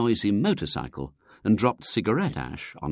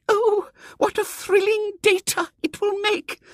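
put-on much higher, awed little old lady; breathed; 5 dB louder than usual. Miss Simpke thrilled by the plan, breathy awe. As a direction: sigh it out in thrilled wonder. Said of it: "breathed little Miss Simpke".

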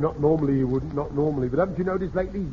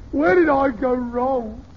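hammed-up lament; 7 semitones higher, 4 dB louder, slower than usual. Hammed-up fatherly woe.

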